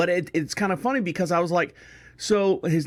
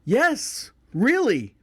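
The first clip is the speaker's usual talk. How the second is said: high-pitched emphatic "Yes, really"; insistent confirmation; 6 semitones higher, much slower than usual. Retold or imitated. Uncle answering his doubting family, emphatically insistent. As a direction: insist on it, each word peaking high then dropping. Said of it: "like, going".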